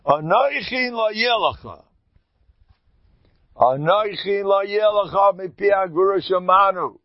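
high, ringing recitation; declaimed verse. Real, not acted. Teacher proclaiming the first commandment to listeners, declaimed high.

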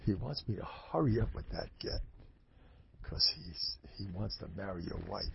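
hushed, acted confession; confiding reluctantly. Reluctant Rav reveals the secret.

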